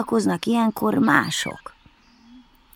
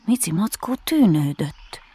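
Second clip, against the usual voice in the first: ordinary delivery, nothing marked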